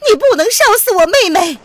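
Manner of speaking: high, loud desperate shriek; piercing, horrified cry; anguished scream. A narrator's reading. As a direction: scream to save a sister. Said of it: "宋飞宇惨叫着".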